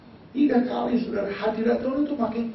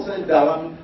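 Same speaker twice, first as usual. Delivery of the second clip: ordinary talk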